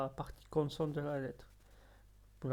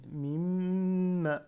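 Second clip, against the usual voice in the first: MIM with the M held long and louder; M retenu; 4 semitones higher, 7 dB louder than usual. Shown as a demonstration. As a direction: lean on the M of "mim", higher. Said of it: "je la retiens la mim". (m retenu longuement dans « mim »)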